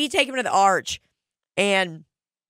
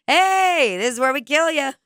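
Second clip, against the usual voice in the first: imitating Mark Normand; 6 semitones higher, 4 dB louder than usual. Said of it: "Mark Normand goes".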